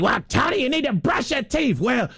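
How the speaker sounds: high-pitched mock parental squabble; hammed-up high quarrelling voice; screaming parents. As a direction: imitate bickering parents in a high comic voice. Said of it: "be screaming".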